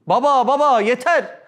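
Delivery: put-on slow plea of a child to his father; neglected child. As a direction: beg like the ignored second child. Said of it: "İkinci evlat çıldırır".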